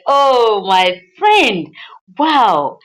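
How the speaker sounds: loud, joyful amazement at a friend's success; acted-out thrilled friend; delighted exclamation. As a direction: exclaim with big, happy surprise. Friend joyfully greets the new restaurant.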